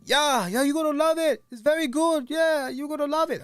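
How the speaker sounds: high, loud mock African accent; African friend. Friend promising he'll love the tape, in a high, loud mock accent. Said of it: "he was an African dude".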